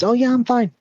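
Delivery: very high, carefree kid imitation; suddenly fine child. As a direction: snap back as if nothing happened. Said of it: "Snaps out of it. No problems".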